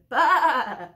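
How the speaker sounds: loud bleat; scared lamb calling for the shepherd. Acted. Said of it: "he said".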